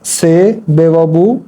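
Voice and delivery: slow, letter by letter; slow spelling out. Shown as a demonstration. Teacher spelling a word for Urdu learners, slowly. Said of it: "इसको मैं दोबारा लिख देता हूँ".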